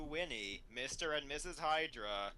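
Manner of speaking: usual speaking voice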